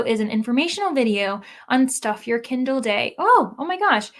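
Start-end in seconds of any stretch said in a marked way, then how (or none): 3.2-4.1 high, loud startled exclamation; sudden delighted alarm; surprised. Real, not acted. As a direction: gasp with surprise. Learns about Kindle day.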